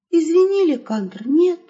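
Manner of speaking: ordinary delivery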